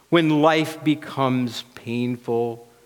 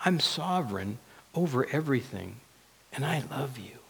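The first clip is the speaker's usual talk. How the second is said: hushed, tender assurance; God's voice; 10 dB quieter than usual. Acted. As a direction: speak as God, very softly and lovingly. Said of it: "God's word and his voice says".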